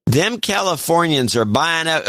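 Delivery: a touch of Texan-like cowboy twang; cowboy accent. Acted. Reading a Montana donor's complaint about Californians, in a mild cowboy accent. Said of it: "an accent themselves. It tends to be a cowboy accent".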